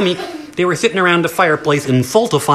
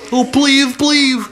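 pleading bear; 8 semitones higher, slower than usual. Acted. Bear begs at the door.

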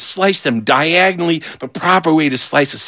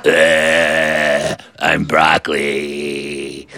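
much lower, very slow and mopey; sad broccoli; 12 semitones lower, much slower than usual. Retold or imitated. Broccoli in green fluid feeling sorry for itself, low and slow. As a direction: drag it out like a vegetable that hates itself. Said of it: "It's like".

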